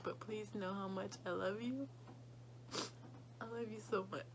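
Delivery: soft, tear-choked words to grandma; telling Gamma she loves her, voice thick with tears; tearful love. Real, not acted. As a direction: speak to her as if she can hear. Speaking to her dying grandma.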